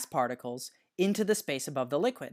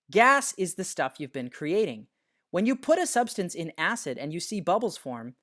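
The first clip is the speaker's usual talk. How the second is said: ordinary talk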